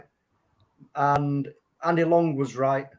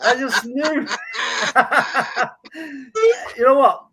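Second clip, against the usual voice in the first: laughing through the words; real laughter breaking in; 8 semitones higher, 5 dB louder than usual. Real, not acted. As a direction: giggle it out, delighted with himself. Gloating about guessing right.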